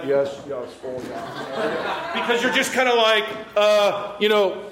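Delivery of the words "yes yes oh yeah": lightly voiced fake phone chat, lower and softer; mock phone call. Person faking a phone call, low and soft. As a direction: pretend to take a call to dodge someone. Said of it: "and you're like".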